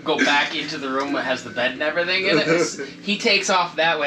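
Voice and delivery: ordinary talk, nothing marked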